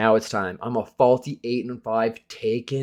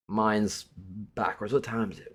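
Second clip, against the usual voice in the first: normal talking voice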